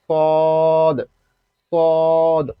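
সদ held long on a level note, final d bounced; drawn-out সদ with an echoed ending; elongated with qalqala. Shown as a demonstration. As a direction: stretch each সদ and release the d. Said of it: "শেষে কলকলা".

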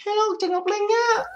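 put-on high, slow girly hello; woman's voice. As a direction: coo the greeting like a girl. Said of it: "chào bằng giọng nữ".

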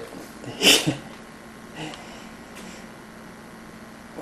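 soft, breath-only laughing; long quiet wheeze of laughter; helpless laughter. Real, not acted. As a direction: laugh because you can't help it. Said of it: "I can't help it".

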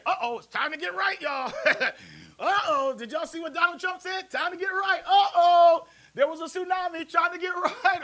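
high loud hammed-up alarm with laughter; mock the panic, laughing; panicky churchgoer. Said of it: "we say".

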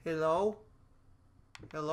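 high, rising questioning call; calling out hello. Real, not acted. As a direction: call out to see who is there. Calling out into the empty house.

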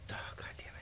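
whispered frustrated swear under the breath; soft annoyed whisper; muttered curse. Real, not acted. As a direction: curse quietly to yourself.

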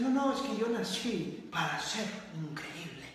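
soft mocking voice with a laugh; soft, laughing parody of someone sure they were born great. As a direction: mimic a smug dreamer, quietly laughing. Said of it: "Muchos dicen".

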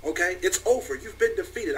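hint of a smug anime villain. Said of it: "I'm not even talking in my anime voice anymore".

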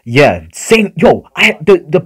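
high, loud excited rush; excited shouting. Real, not acted. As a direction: yell it out, thrilled. Excited about pulling LF Vegeta.

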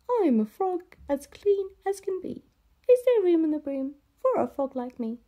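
high, croaking frog voice; croaky frog. Acted. Frog asks the witch for a seat, croaking. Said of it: "said with a croak".

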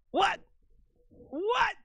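very high, short yelps of disbelief; surprised squeal. Real, not acted. Streamer spotting a melon in the game, high-pitched surprise. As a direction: squeak it in astonishment.

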